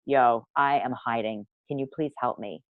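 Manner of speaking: soft, small confession, trailing away; sheepish plea. Retold or imitated. Asking her accountability partner for help, hushed. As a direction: admit it quietly, almost ashamed. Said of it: "run to our accountability partner and say".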